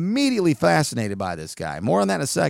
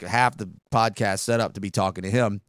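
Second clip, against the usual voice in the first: usual speaking voice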